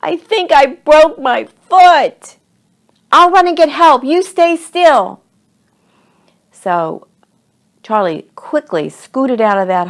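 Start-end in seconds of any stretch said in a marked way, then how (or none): 0.0-2.4 put-on high, loud, slow wail of a hurt boy; crying out. A narrator's reading. Injured Cody calls up to Charlie, loud distressed cry. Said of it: "he was crying". 3.1-5.2 loud, high shouted instructions; urgent calling. A narrator's reading. Charlie calls down to hurt Cody, urgent and loud.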